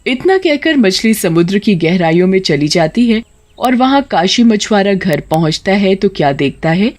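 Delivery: ordinary delivery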